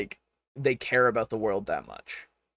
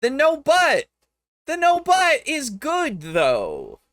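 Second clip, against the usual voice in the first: much higher and louder, slowing down; emphatic defense; 13 semitones higher, 4 dB louder, slower than usual. Real, not acted. To the video's narrator, insisting loudly and high that "no, but" is good. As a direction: insist it hard, like it's obvious.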